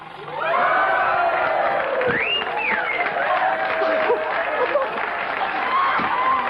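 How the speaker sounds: long high shrieking laugh; falsetto laugh. Acted. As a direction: let out a shrill falsetto hoot.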